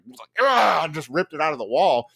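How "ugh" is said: frustrated grunt. Retold or imitated. Ripping out cord.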